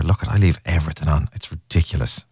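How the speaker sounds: ordinary delivery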